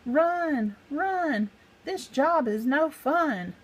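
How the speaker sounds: high, panicked yelling; cowardly yell. Acted. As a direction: yell like you're fleeing for your life. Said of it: "yelled the cowardly, villainous pack".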